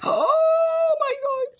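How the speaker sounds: very high, slow gasping delight; retold excitement. Watching a village-buying show, squealing with delight. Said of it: "and I'm like".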